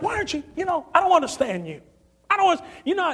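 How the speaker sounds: high-pitched exasperated questioning; imitating baffled, mad boss. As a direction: play a boss who can't understand. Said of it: "and he is mad".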